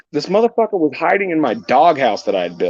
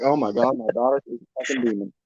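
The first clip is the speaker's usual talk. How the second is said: horrified aside; 6 semitones lower, 9 dB quieter than usual. Retold or imitated. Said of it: "I'm like".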